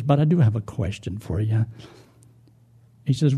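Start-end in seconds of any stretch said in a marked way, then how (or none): 0.0-1.7 low, halting parrot apology; humbled parrot, hesitant and low; half-frozen parrot. Acted. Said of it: "you know, half frozen".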